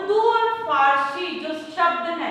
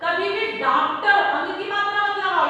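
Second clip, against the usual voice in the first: ordinary talk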